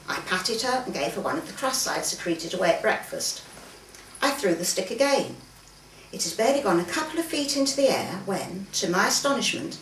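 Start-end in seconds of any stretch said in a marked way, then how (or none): none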